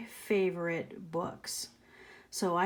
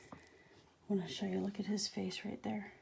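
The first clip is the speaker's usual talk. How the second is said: whisper; 12 dB quieter, faster than usual. Real, not acted. Teacher whispering to the children about the picture, hushed. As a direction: whisper it like a secret to little listeners.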